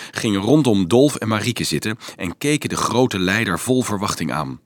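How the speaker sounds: ordinary delivery, nothing marked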